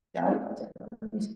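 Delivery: a soft, hushed whisper; half-joking whispered aside. Shown as a demonstration. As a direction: whisper the warning like a secret. Repeats the warning, then whispers it.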